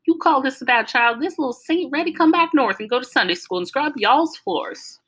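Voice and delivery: hollering down the phone, pitched high; old grandma hollering to her daughter; shouting. A narrator's reading.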